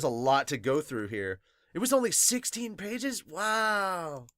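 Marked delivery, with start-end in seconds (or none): none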